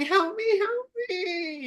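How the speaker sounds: very high, thin tearful wail; mock crying. Dreamer stuck on a cliff begging for rescue, mock crying. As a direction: wail for help in a pretend sob. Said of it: "and I'm, like, crying".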